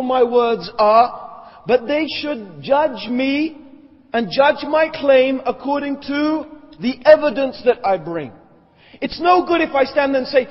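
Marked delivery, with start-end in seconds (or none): none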